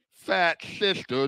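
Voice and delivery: usual speaking voice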